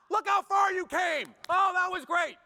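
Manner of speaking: high, loud, slow cheer; cheering parent. Retold or imitated. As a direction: praise him big as he falls. Parent clapping, cheering child.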